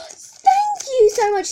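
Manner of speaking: high, loud, slow gushing thanks; overjoyed thanks. Real, not acted. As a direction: thank the shop, overflowing with joy. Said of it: "Sorry if I squeal a lot".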